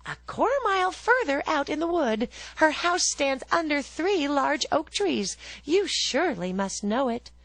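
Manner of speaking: put-on the girl telling the wolf the way, high; little girl. A narrator's reading. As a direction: chirp it like an eager little girl. Girl voiced in a story.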